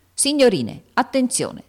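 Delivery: stentorian order. A narrator's reading. Angry teacher silencing his chattering class, stentorian order. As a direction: call the whole class sharply to order. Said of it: "detto con voce stentorea".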